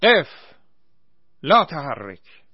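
loud, slow barked order in Arabic; shouted command. A narrator's reading. Naser yells at the Iraqi prisoners to halt, loud. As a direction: stop them with a yell. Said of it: "ناصر به آنها رسید و فریاد زد".

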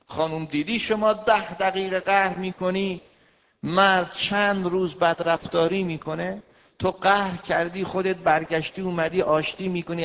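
loud, high fiery sermon voice; preaching. Acted. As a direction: hammer the point home to the women. Preaching at full force.

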